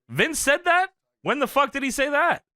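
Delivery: high-pitched disbelieving retort; acted-out disbelief, pitched well up; incredulous. Acted incredulous reply in story.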